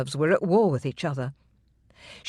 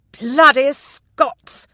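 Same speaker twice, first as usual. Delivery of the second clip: put-on high, loud, slow drawn-out drunken yell; drunken shout; 8 semitones higher, 6 dB louder, much slower than usual. A narrator's reading. Drunk steward shouting from the buttery doorway, slurred yell. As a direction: bellow it across the hall. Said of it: "came a shout from the doorway".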